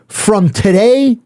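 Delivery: slow, emphatic retold proclamation; solemn decree. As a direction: hand down a ruling like a mob captain. Mob captain decrees his new allegiance.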